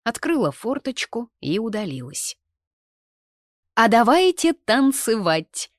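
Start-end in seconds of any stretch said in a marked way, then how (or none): 3.8-5.7 громче и выше, растягивая слова; выкрикни протяжно, зазывая всех; задорный выкрик. A narrator's reading. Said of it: "закричала Тинка". (громкий протяжный призыв)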